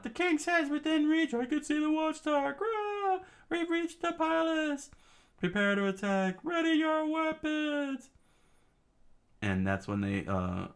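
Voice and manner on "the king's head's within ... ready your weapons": rally for the attack; charging rebels. Acted. Rebels shouting as they storm the palace. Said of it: "screaming out".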